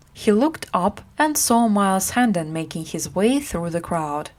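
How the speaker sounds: plain reading voice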